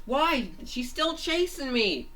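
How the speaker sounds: high, loud cartoon panic; alarmed protest. Fangy is chased by the zombie, alarmed protest. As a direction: protest at the top of your range.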